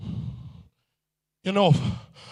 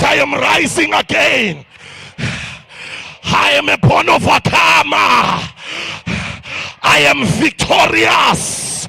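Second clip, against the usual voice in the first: louder, firm declaration; say it like you mean it; 6 semitones higher, 4 dB louder than usual. Shown as a demonstration. Preacher leading the crowd's bold repeat, said with conviction. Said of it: "Say it like you mean it".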